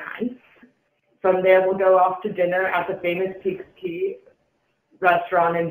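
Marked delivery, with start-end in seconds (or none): none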